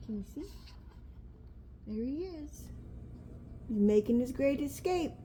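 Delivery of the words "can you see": ordinary delivery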